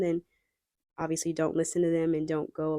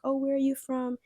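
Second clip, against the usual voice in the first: lightly voiced curious stranger, pitched higher; higher-pitched, mock-interested question; flirtatious stranger; 7 semitones higher than usual. Flirting stranger asks a foreign traveller, lightly acted high interest. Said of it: "they start flirting with you".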